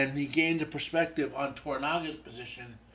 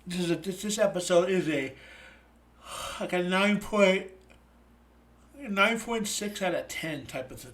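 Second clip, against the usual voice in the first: yawning; 5 semitones higher, 3 dB louder than usual. Real, not acted. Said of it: "Sorry if I'm yawning".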